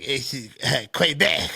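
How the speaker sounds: high, loud mock Quebec accent; mock French Canadian. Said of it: "I love making fun of French Canadians".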